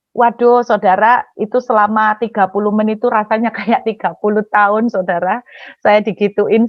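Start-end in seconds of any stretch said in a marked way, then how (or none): none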